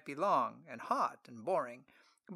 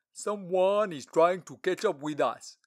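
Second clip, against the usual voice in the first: loud command; 4 semitones higher, 9 dB louder, slower than usual. Acted. Treasurer orders the caravan halt.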